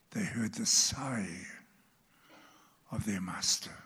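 hushed, whispered preaching; intimate whisper. Real, not acted. Preacher whispering to his congregation about hearing the word. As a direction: draw them in with a whisper.

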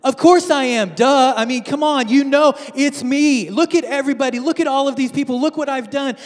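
high, loud, cocky show-off voice; boastful Jesus. Acted. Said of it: "Jesus doesn't do this".

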